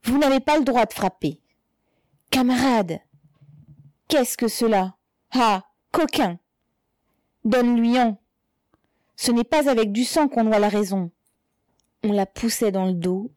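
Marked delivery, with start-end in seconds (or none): 0.0-11.1 raised, higher-pitched outcries; outraged exclamations. A narrator's reading. Crowd exploding as the mother is struck, outraged exclamations. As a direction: burst out in protest. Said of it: "Une explosion d'exclamations".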